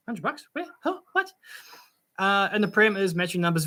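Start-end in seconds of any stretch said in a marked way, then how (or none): 0.1-1.3 higher, softer puzzled questions; baffled questioning. Real, not acted. Streamer puzzled about where the hundred bucks is, baffled questioning. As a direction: query it in quiet, rising disbelief.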